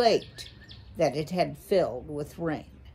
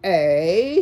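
growled name, the eh punched much louder; 7 dB louder, slower than usual. Acted. An owl menacing a small mouse, growling. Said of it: "he growled".

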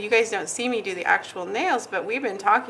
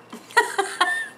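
breathy laugh; 10 semitones higher than usual. Real, not acted. Laughs quietly.

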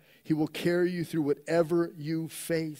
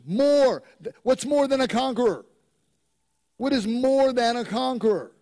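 louder, higher preaching, pressing each point; full-force preaching; 5 semitones higher, 6 dB louder than usual. Acted. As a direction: preach it out with rising fervor.

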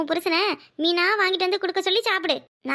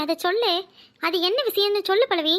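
ordinary talk, nothing marked

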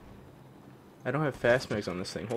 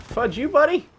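angry yell; 12 semitones higher, 7 dB louder than usual. Real, not acted. Yelling at enemy.

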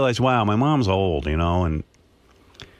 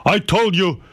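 higher, slower mock voice of a bossy father; father scolding mother over the fortune, higher mock voice; imitating her father; 9 semitones higher, slower than usual. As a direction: put on dad's fussy scolding voice, drawn out. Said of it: "He goes".

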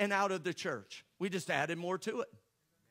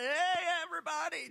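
much higher, chirpy greeting; wife parading around, higher and sing-song; mock cheery wife; 8 semitones higher than usual. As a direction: show off the pregnancy with a bright hello. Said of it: "it's like".

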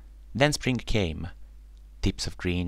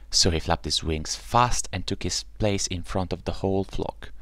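ordinary delivery

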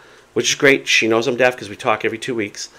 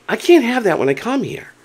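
retold protest in a high pitch; high, aggrieved objection; aggravated complaint; 12 semitones higher than usual. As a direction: replay your exasperation, voice pitched high. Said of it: "I'm aggravated".

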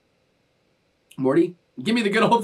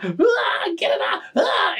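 high, panicked mock screams; retold screaming; 13 semitones higher, slower than usual. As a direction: shriek in hammed-up panic. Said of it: "I am screaming".

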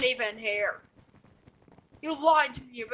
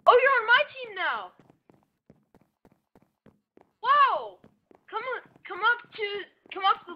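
excited; 10 semitones higher, 3 dB louder than usual. Real, not acted. Excited teammate joins.

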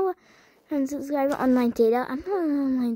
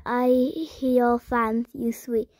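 normal talking voice

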